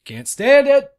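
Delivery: real exasperated yell; exasperated outburst. Real, not acted. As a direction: burst out fed up. Exclaims about quiet house.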